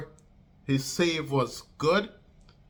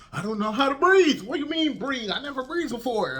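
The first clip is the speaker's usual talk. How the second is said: very high-pitched, comic panic; panicked wailing; 11 semitones higher, 4 dB louder than usual. Retold or imitated. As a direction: wail it in hammed-up helpless panic. Acting out panicked reply while drowning.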